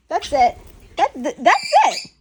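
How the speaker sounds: emphatic. Real, not acted. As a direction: hammer home "That's it". Repeats That's it louder.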